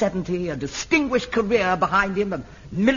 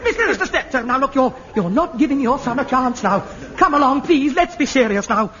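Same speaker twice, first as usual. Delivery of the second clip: higher, louder flustered appeals with laughter; exasperated pleading; 6 semitones higher, 5 dB louder than usual. Real, not acted. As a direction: try to restore order in a fluster. Director pleading for calm.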